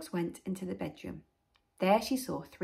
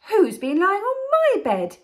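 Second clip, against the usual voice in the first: higher, loud mother bear voice; medium-sized Mother Bear; 12 semitones higher, 10 dB louder than usual. Acted. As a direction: ask indignantly as the mother bear, pitched up. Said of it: "in a medium-sized voice".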